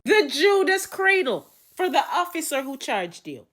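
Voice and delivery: high, loud theatrical ruling; booming mock verdict; sentencing judge. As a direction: proclaim the punishment grandly.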